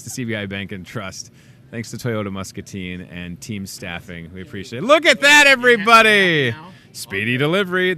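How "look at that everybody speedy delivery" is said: loud, high excited announcement. Real, not acted. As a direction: shout it out, thrilled. Cheese curds arrive on set.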